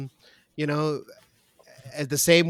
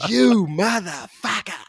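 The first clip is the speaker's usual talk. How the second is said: slow, raised mock fury, drawn out; mock curse; 7 semitones higher, 5 dB louder, slower than usual. Imagining Ardern kicking the minister out, slow mock rage. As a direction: spit it out slowly like a furious boss. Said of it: "and say".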